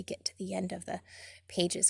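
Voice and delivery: soft unvoiced whisper. Real, not acted. Reader whispering to the children. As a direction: drop to a hush.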